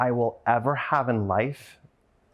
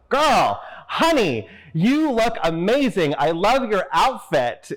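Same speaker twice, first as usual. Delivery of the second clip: much higher and louder, gushing over a stranger's outfit; loud, high delighted praise; gushing compliment; 8 semitones higher, 4 dB louder, slower than usual. Retold or imitated. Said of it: "Bonnie stopped and goes".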